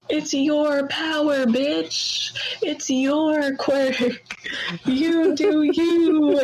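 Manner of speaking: slow, drawn-out pep talk; slowed-down motivational hero, the last words held out; mock inspirational speech. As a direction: lay on the life lesson like a hammy mentor. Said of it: "and he's like".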